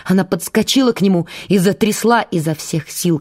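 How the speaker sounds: plain reading voice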